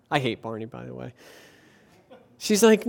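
ordinary delivery

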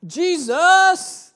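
high, loud ringing Jesus; shouted name. Shown as a demonstration. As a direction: proclaim Jesus at full voice. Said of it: "the name that we are to be shouting".

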